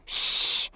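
soft, urgent "shh"; worried shush. A narrator's reading. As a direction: hush them worriedly. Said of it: "Erica said worriedly".

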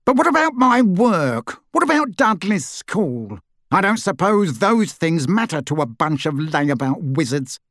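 high blurted protest, shifting voice; blurting uncle. A narrator's reading. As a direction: blurt it out in panic. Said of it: "Uncle Vernon blurted out".

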